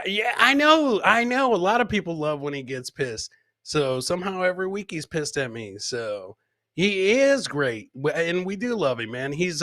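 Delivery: usual speaking voice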